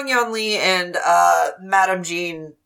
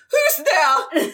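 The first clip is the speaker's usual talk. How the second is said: high posh lady voice; posh Madame Jean calling out; 10 semitones higher than usual. Acted. Madame Jean hears someone sneaking off, high posh challenge. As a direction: call out as a high, grand lady. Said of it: "And she goes".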